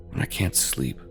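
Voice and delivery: ordinary delivery, nothing marked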